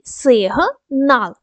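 "Сигнал" in two slow, separate syllables, "Сиг - нал"; split into syllables. Shown as a demonstration. Teacher showing pupils the two syllables of "Сигнал", syllable by syllable. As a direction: break "Сигнал" after "Сиг", pausing before "нал". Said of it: "Два склади".

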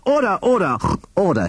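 high-pitched judge's call; crying out for order. A narrator's reading. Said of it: "he cried".

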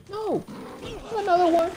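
high, loud shout of protest; alarmed cry. Real, not acted. Player spotting another enemy, alarmed shout. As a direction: cry out as another attacker appears.

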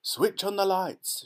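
loud, high and slow command; shouting. A narrator's reading. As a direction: call it out over the whole tunnel. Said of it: "shouted Mister Wonka".

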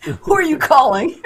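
high, loud parental demand; hammed-up parent shouting down the phone; mock parent. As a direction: imitate an impatient parent grilling a caller. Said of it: "You sound like somebody's parent".

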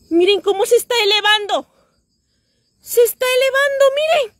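climbing excitement, almost shrieking; thrilled. Real, not acted. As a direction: urge everyone to look, overwhelmed. Object rising in the sky.

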